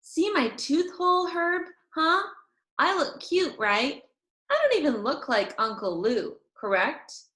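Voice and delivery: put-on high, eager little girl voice; girl showing her friend her missing tooth; Junie B showing off. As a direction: show off your gap to your friend. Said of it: "I smiled and pointed".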